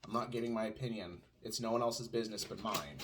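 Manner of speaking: ordinary talk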